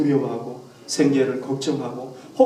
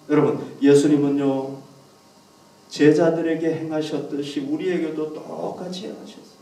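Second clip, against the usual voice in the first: ordinary talk, nothing marked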